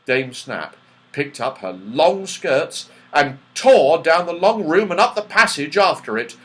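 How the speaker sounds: ordinary delivery, nothing marked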